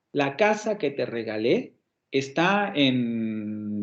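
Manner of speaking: La casa que te regalé está en, stretched very slow with held final vowels; slow demo reading. Shown as a demonstration. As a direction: drag each word out to display the intonation. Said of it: "la entonación va decreciendo". (lectura muy lenta de demostración)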